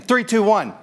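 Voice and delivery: cue the room to shout; countdown call. Real, not acted. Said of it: "counting down for the group".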